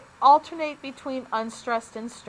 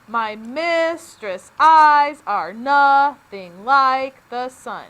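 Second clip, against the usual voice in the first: slow and louder, each second syllable hit: "My mis-TRESS' EYES are NOTH-ing LIKE the SUN"; iambic stress; 9 dB louder, much slower than usual. Shown as a demonstration. Teacher reading Shakespeare to students, slow and stressed on the beats. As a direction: beat out the line slowly, leaning hard on every second syllable. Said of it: "Notice I put the stress on the second syllable".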